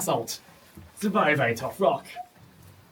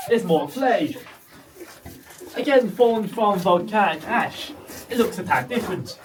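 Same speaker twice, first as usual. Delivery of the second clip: ordinary delivery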